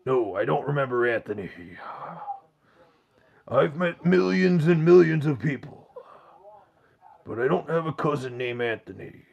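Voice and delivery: constipated-sounding wrestler impression; Macho Man Randy Savage. Streamer answering a chat question, strained wrestler impression. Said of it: "as Macho Man".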